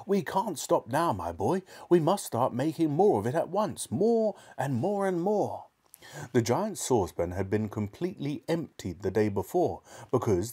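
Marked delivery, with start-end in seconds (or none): 0.1-5.7 high, eager urging; excited. A narrator's reading. Father urges his son to make more medicine, excitedly. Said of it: "in a state of greater excitement".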